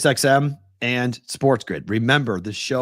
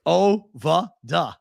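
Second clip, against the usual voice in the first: Ovada drawn out slowly, no R, Boston style; 6 semitones higher, much slower than usual. Shown as a demonstration. Defending his Boston speech, slow Ovada. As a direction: stretch Ovada wide with a dropped R. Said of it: "We don't say the R".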